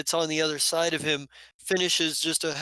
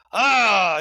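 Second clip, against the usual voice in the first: loud, high shouted greeting sound; booming delighted ah; 5 semitones higher, 9 dB louder than usual. Acted. Gladiator Hafis greeting his friend across the bar with a loud ah. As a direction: let out a big hearty roar of recognition. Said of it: "Hafis kinda turns around and goes".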